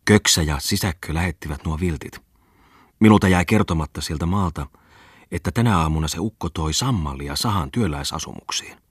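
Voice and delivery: usual speaking voice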